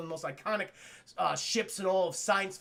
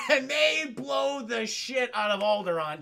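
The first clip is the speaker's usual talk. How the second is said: usual speaking voice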